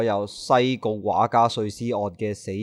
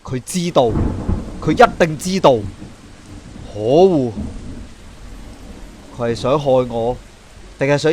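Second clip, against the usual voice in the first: rising anger; 2 semitones higher than usual. Acted. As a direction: let the realisation boil over. Detective realises he is targeted.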